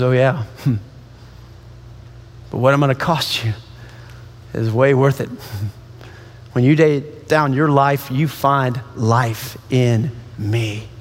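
low, hushed and unhurried; tender assurance. Acted. Jesus answering those afraid of the cost, low and tender. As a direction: answer gently, pitched well down.

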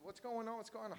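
very soft, high-pitched coaxing; hushed pleading. Retold or imitated. Father to his baby in a night terror, hushed pleading. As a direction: plead under your breath, high and hushed.

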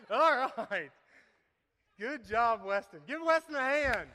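big, loud call for applause; rally the crowd to clap for the lifter; loud congratulation. Real, not acted. Cheering the volunteer after the lift.

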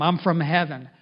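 ordinary talk, nothing marked

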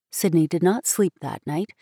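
ordinary delivery

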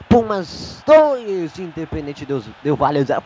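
rapid radio commentary; play-by-play. Acted. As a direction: rattle off the play live. Live play-by-play.

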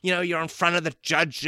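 usual speaking voice

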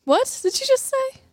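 can't believe what she heard; surprised. Real, not acted. Co-host stunned by the palm tree claim, surprised.

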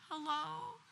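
answering the phone sounding tired on purpose; faked tired voice. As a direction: pretend you just woke up. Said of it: "I tried to sound really tired".

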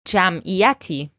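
Jam'iyati spoken slowly, syllable by syllable; slow model word. Shown as a demonstration. Teacher repeats a tricky word slowly for learners. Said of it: "Let's say this again since it's a little bit of a tricky word".

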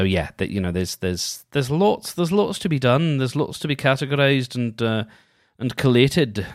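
usual speaking voice

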